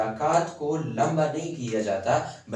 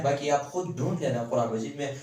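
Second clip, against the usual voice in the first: ordinary talk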